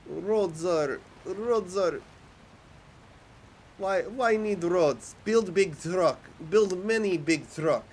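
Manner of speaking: high, slow mock Russian accent; hammy broken-English Russian comrade; terrible Russian accent. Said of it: "in terrible Russian accent".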